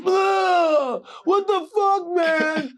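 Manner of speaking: very high, loud mock-crying complaint; hammed-up tearful yelling; anguished wailing. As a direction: wail accusingly after throwing up. Che retching and wailing at friend.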